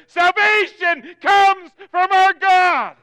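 very high, drawn-out shout; loud cry. Acted. Preacher voicing the crowd round the throne, loud cry. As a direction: shout it slowly as the whole multitude. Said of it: "they cried out in a loud voice".

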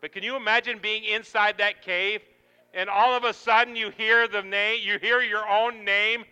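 loud, high, mounting sermon voice; building preaching. Acted. Preacher picturing Lazarus hearing his name, building force. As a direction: build the suspense at full voice.

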